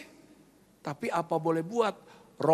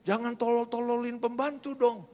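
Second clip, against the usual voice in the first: mock scolding, pitched up without shouting; retold fatherly scolding; 6 semitones higher than usual. Father scolds child for insulting the maid, mock scolding. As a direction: imitate a dad's mild telling-off. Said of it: "Papa saya marahin".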